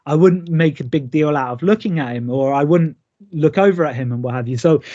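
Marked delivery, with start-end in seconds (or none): none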